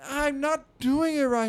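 high mock child whine; whining kid. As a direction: whine like a frustrated little boy. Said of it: "Stop whining".

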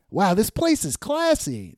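high-pitched cartoon wonder; impressed Ziggy. Ziggy admires the fancy restaurant, hammed-up wonder. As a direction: play naive awe at the place. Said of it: "That's Ziggy saying that".

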